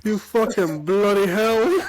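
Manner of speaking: high, slow mock English accent; terrible English accent. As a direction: drag it out in a badly faked English accent.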